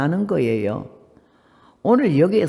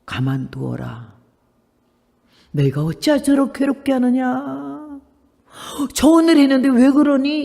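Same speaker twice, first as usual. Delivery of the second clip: Jesus speaking; 6 semitones higher than usual. Acted. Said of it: "주님 말씀해 주셨어요".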